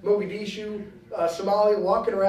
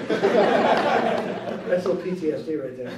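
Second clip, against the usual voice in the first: laughing; slower than usual. Real, not acted. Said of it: "Look out".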